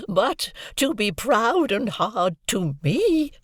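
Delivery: slow, high, anguished cry; shrieking. A narrator's reading. Havisham shrieking at Estella, arms outstretched. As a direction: cry out in hurt. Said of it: "Miss Havisham quite shrieked".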